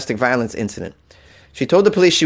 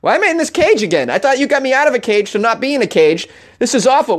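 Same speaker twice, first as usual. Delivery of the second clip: high eager dog voice, much higher; a naive happy dog turning worried; voicing the dog; 9 semitones higher than usual. Voicing Bubba the husky, high naive dog voice. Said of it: "the poor dog is like".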